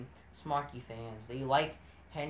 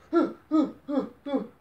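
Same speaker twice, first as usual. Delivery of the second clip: high, loud theatrical gasp; mock gasp; 9 semitones higher, 7 dB louder than usual. Mock shock at brawl.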